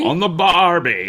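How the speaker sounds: put-on Italian accent. Finishing the shrimp on the barbie joke in a mock Italian accent. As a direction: ham up a light Italian accent. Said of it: "That's my Italian accent".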